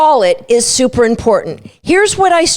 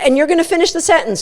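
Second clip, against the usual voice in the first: ordinary talk, nothing marked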